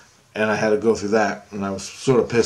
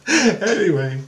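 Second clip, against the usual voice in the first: usual speaking voice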